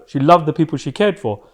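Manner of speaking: usual speaking voice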